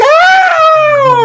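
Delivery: very high shouted "ah"; mock pained scream. Retelling woman stepping into trash can, mock pained scream. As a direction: imitate a shriek of sudden pain.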